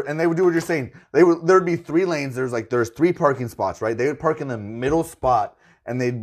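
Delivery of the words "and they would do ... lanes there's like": ordinary talk, nothing marked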